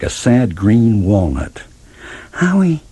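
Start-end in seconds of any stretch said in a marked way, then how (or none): 2.3-2.8 high, slow, pleading talking-cabbage voice; a small vegetable begging not to be left; high drawn-out plea from a cabbage. Acted. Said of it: "it begged".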